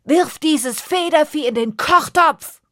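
high, loud furious bellow; roaring. Acted. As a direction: bellow at the cook in rage. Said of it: "Er brüllte nach seinem Koch".

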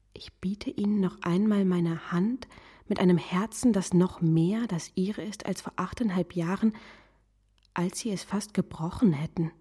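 whispered confession; a whispered plea to the woman he loves. Acted. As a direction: breathe the words, barely voiced. Love letter, intimate confession.

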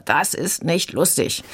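lightly voiced sulky small boy, slowed; a touch of a pouting kindergartner; whining little brother. Critic imitating little Pippo's complaint, mild sulky whine. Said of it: "Und Pippo schreit dann wieder".

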